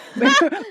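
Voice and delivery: a high burst of real laughter. Real, not acted. Voice coach laughing at her own impression, high.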